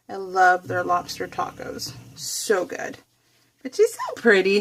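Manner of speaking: ordinary talk, nothing marked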